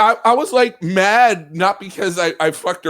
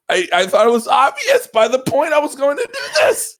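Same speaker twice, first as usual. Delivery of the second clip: ordinary delivery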